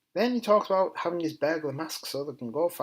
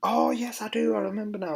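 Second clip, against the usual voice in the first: high, loud burst of remembering; sudden recollection; 10 semitones higher, 6 dB louder than usual. Real, not acted. Vlogger suddenly remembers what the note meant, excited burst. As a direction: start high and loud on a dawning "oh". Sudden recall after admitting forgetting.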